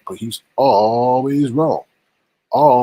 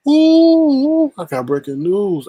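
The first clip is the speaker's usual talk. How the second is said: hyped announcement; 11 semitones higher, 4 dB louder, slower than usual. Acted. Announcing breaking news, hyped.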